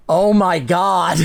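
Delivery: slow, drawn-out disbelief; exasperated groan. Real, not acted. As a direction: sigh it out, fed up with the text. Reacting to the fanfic text.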